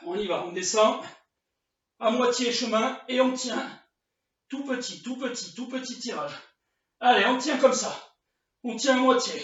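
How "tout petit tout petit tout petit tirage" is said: much softer tout petit, tout petit; soft cue. Shown as a demonstration. Coach talking viewers through tiny chair rows, soft cue. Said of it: "des tout petits mouvements".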